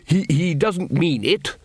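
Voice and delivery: normal talking voice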